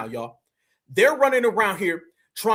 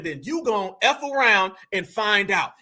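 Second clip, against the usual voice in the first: ordinary talk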